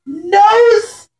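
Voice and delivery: ordinary delivery, nothing marked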